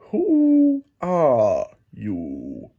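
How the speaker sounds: very slow, drawn-out question; lazy caterpillar voice. Acted. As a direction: let each word hang like smoke. Caterpillar speaks.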